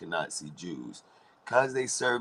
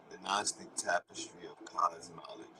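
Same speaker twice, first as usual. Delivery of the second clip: whisper; 7 dB quieter than usual. Shown as a demonstration. Repeating the phrase to viewers in a whisper. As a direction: whisper "The Gnostic tapestry of cosmology" with no voice. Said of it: "repeats it whispered".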